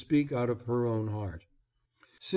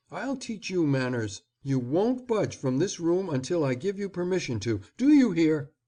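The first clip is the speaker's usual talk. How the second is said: mother punishing her son, sternly raised voice; 6 semitones higher than usual. A narrator's reading.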